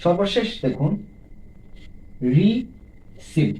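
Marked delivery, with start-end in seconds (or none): 2.4-3.5 "receive" as rising রি, long pause, সিভ; syllable by syllable. Shown as a demonstration. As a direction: slowly split receive in two. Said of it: "এইভাবে আমরা সহজে syllable".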